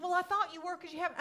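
fast, high, loud retort; flustered protest. Retold or imitated. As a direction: retell a hurried self-justification. Acts out the misunderstanding.

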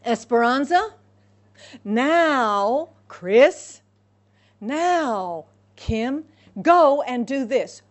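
names called out loudly across a room; calling out loud. Acted. Calling names out loud to the congregation. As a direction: summon each one by name, loud. Preacher acts out God calling people.

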